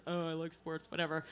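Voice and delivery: low, bored dude voice; a lightly voiced boyfriend brushing her off; dismissive boyfriend. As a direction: shrug it off like a bored guy. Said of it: "And he's like".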